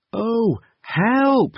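high, frightened cry for help. Acted. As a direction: call out in panic. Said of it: "he said".

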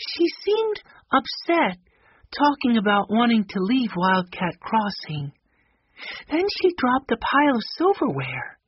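very high-pitched character voice, concerned; high, sweet storybook voice reporting trouble; concerned Violet. Acted.

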